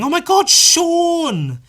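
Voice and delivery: high, loud, stretched-out delighted cry; excited greeting. Retold or imitated. Yvonne surprising Sean, a high drawn-out delighted cry. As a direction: call out his name in drawn-out glee.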